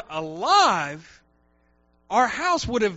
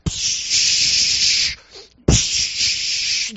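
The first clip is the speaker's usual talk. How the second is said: hissing spray noise; short unvoiced psh sounds; 5 dB louder than usual. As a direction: imitate the spray can hissing. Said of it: "So I'm like".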